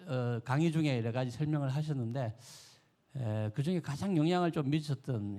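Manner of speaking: ordinary talk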